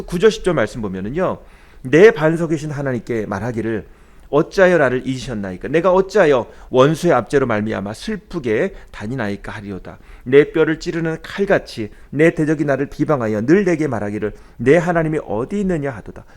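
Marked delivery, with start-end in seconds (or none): 1.8-10.0 scripture reading. Acted. Reads scripture verse. 10.2-16.2 scripture reading. Acted. Reads scripture verse.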